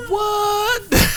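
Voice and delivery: high, loud, incredulous "what"; shocked outburst. Retold or imitated. Reacting to a story just heard, loud mock shock. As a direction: blurt it out in mock disbelief.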